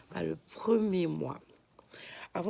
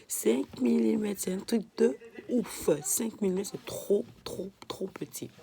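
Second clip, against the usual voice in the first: excited; 5 semitones higher, 5 dB louder than usual. Real, not acted. Marvelling at tiny size.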